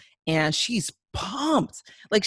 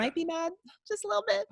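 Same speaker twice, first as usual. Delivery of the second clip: high, quick little aside; squeaky, knowing tease; teasing; 14 semitones higher, 4 dB quieter, faster than usual. Real, not acted. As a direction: needle him in a tiny high voice. Teases the retold denial.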